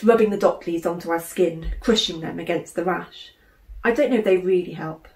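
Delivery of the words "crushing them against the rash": usual speaking voice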